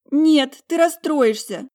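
high, loud, drawn-out wail; distressed protest. A narrator's reading. Talia shielding her sister from upset, wailing. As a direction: beg her desperately to stay out. Tearful sister blocking the door.

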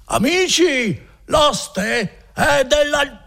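put-on high, slow drunken yell to friends; drunken shouting. A narrator's reading. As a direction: call out to the friends downstairs. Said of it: "cominciava a urlare più forte ancora". (urlo ubriaco, alto e lento)

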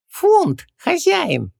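very high, squeaky, servile genie; Хубаксис. A narrator's reading. Genie prompts his master with the word, servile squeak. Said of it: "подобострастно сообщил Хубаксис".

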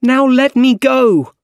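high, loud drawn-out demand; shouting. A narrator's reading. Captive MJ demands Doc Ock free her, shouting. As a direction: shout it high, stretching the words. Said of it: "she shouted at Doc Ock".

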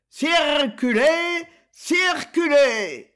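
high, slow, loud police shout; police officer voice. Acted. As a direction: bellow like a traffic cop. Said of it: "il imitait la voix d'un agent de police".

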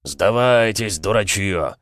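drawn-out commanding call in character; battle shout. A narrator's reading. War machine calls on pirates to surrender, drawn-out shout. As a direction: shout a haughty order to surrender, falling at the end.

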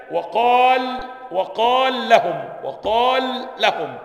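slow, loud drilled madd in وقال لهم; lengthened madd. Shown as a demonstration. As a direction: draw out the ا of وقال before the merged لام. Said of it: "حالة الإدغام".

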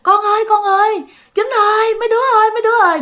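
imitating eerie sleep-talk calls; old man calling out in his sleep. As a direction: call everyone from inside a bad dream. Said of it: "cứ kêu rằng á là".

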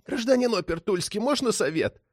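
high, strangled little voice; pinched, wheezy request; wheezing plea. A narrator's reading. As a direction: squeeze it out as if grabbed by the collar. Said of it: "но успел просипеть".

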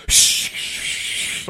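whispered shh shh; mock someone shushing you. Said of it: "It was like".

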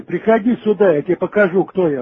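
ordinary talk

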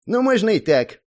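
high, loud grumpy gnome; disgruntled grumble. A narrator's reading. Gnome answering the puzzled hero, disgruntled. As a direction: grumble it in a high little-man voice. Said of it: "буркнул недовольно он".

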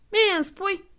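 high, mocking question; ironic retort. The thief answers Santa with a high mocking retort. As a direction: toss it back sarcastically.

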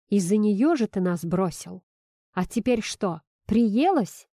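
ordinary delivery, nothing marked

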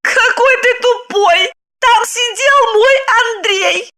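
high, slow, laughing scorn; mocking wife. Wife sneers at her dumb husband, slow high mockery. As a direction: drag it out to belittle him.

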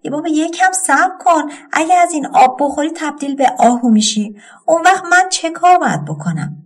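high-pitched, lightly acted pleading; storyteller's mild tearful wail; tearful cry. A narrator's reading. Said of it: "گریه کنان داد زد".

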